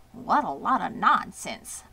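usual speaking voice

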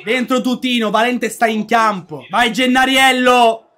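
ordinary talk

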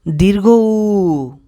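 "দীর্ঘ উ" very slow, উ held and falling; model pronunciation. Shown as a demonstration. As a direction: sound out "দীর্ঘ উ", stretching the উ. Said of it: "সঠিক উচ্চারণ হবে". ("দীর্ঘ উ", শেষের উ টেনে)

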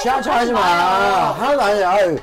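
slow slurred fake drunk voice. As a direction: slur it like you're pretending drunk. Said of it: "일부러 또 눈에 힘 푸는 거 봐".

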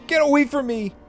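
put-on high, loud screaming boy; terrified child's cry. Boy fleeing his mother screams in terror. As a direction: shriek it like a panicked kid. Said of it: "he cried".

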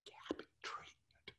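hushed, as if it were a dirty word; dirty-word whisper. Retold or imitated. Mocking the defense's phrase in a hushed whisper. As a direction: whisper it like something shameful. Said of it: "they say it like a dirty word".

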